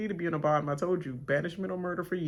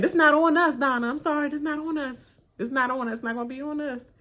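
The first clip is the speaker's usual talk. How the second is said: higher, louder fake-sorry voice; a loud, pitched-up imitation of excuses; mock apology; 8 semitones higher, 6 dB louder than usual. The group apologising to Donna, in a loud high mock voice. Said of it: "It was like".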